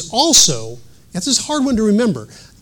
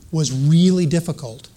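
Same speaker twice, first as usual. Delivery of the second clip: normal talking voice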